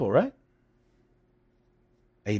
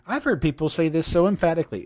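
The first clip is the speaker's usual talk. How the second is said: ordinary talk, nothing marked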